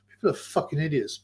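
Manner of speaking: ordinary talk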